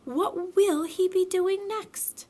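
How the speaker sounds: high, slow squeaky imitation; mother playfully copying Piglet; Kanga's mock Piglet voice. A narrator's reading.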